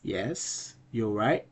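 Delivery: ordinary delivery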